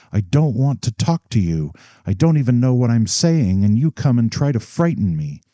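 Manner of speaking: nearly out of control with panic; almost hysterical. Acted. Bride on her last night, almost hysterical at father. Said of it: "The girl was almost hysterical".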